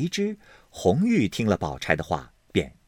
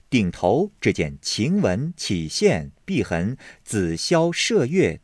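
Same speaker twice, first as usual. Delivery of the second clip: ordinary delivery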